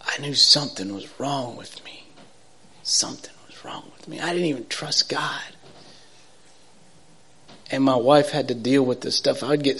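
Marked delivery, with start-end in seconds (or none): 0.0-5.4 hushed confession, no voice; soft whispered admission. Real, not acted. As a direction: breathe it out like a secret you're ashamed of.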